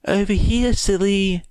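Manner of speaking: put-on high, teasing girlish voice; playful girl voice. A narrator's reading. Twice clone voicing Himiko.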